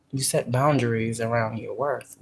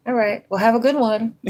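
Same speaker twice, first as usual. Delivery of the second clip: higher and louder, sugary customer voice; sweet polite brush-off; 9 semitones higher, 6 dB louder than usual. Retold or imitated. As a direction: dismiss them with a bright fake smile. Acts calm polite brush-off.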